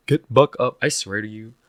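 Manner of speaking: usual speaking voice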